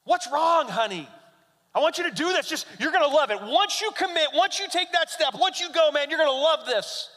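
high, encouraging coaxing; coaxing dad. Retold or imitated. Dad coaxing his scared daughter to jump, high and upbeat. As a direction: sweet-talk her into going.

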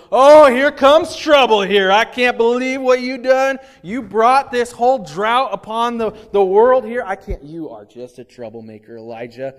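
loud, high, mocking king's sneer; sneering accusation. King Ahab jeering at Elijah, loud and mocking. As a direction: jeer at him as he walks in. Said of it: "the guy walks in the room and he goes".

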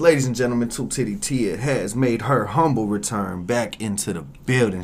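loud, high hype announcer; podcast host intro. Acted. Host welcoming Two Titty Tia back, loud hype intro. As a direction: announce the show at full volume, easing off towards the end.

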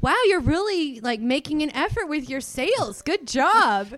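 loud, high, gushing mock praise; impressed boss. Boss praising an employee's sales effort, over-impressed. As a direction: play up the boss's amazement.

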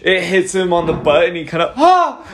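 sudden high yelp of pain. Acted. GM voices the struck bandit with a high yelp. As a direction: yelp like he's been hit in the butt.

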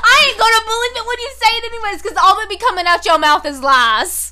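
high, loud, fast sassy comeback; loud mocking retort. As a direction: snap back at the ex. Mock reply to ex.

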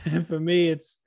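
ordinary talk

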